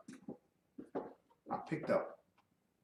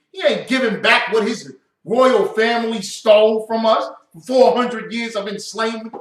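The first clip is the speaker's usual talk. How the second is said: loud, higher-pitched rant; angry scolding; 4 semitones higher, 7 dB louder than usual. Real, not acted. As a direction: tear into him, raised voice. Angry rant at Prince Harry.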